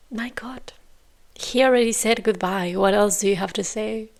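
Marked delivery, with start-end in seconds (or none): 0.1-0.7 unvoiced dismayed whisper; whispered disbelief. Real, not acted. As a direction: breathe it out in dismay. Watching dismayed.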